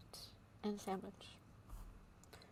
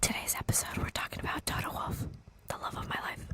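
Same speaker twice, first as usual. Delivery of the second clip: ASMR whisper. Pretend. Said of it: "This is an A S M R podcast".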